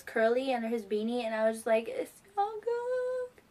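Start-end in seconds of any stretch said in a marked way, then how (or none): 1.9-3.4 high, drawn-out mock squeal; long high swoon, retold; starstruck squeal. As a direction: stretch it into a high fangirl squeal. Retold fan excitement on seeing idol.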